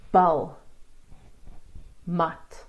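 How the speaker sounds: "bal" and "mat" with a brief "ah"; short A. Shown as a demonstration. Teacher modelling Afrikaans words, short A in "bal, mat". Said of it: "is a short A".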